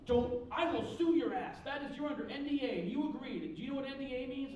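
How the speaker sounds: high-pitched imitated legal threat, hammered out; yelling. Company man threatens to sue cartoonist, imitated yelling. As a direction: threaten him like an irate caller. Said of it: "he's yelling at me".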